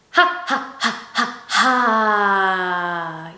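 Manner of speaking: short puffed ha's, then a long held ha; breath exercise ha. Shown as a demonstration. Teacher counts out a breathing drill, puffed ha's. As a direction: puff ha four times, hold and empty out. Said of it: "we're gonna say".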